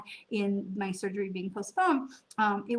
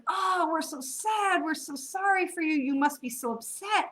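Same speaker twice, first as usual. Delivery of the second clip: mock sympathy; 7 semitones higher than usual. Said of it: "everyone was like".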